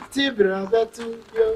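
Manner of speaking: usual speaking voice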